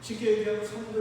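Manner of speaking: ordinary delivery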